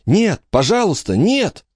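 high, anguished plea; pleading. A narrator's reading. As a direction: beg it not to be true. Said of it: "взмолилась она".

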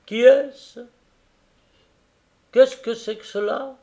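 high, loud, needling voice; sly Tackleton. A narrator's reading. Tackleton needling the carrier about his wife, high and loud. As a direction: prod the husband with mock respect.

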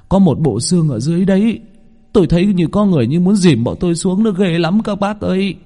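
high-pitched, frightened survivor's voice; frightened stammerer. A narrator's reading. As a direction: tell the villagers in terror what's down there. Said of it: "Ông lắp bắp". (giọng sợ hãi, cao vút, kể lại điều kinh hãi)

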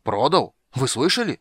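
loud, indignant outburst; boiling over. A narrator's reading. Halfling learns his horses were sold, boiling over. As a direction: explode in outraged disbelief, pitch climbing. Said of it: "вскипел Низушек".

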